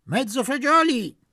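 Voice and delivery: full shout of the order. Acted. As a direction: yell the order across the room. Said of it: "gridò".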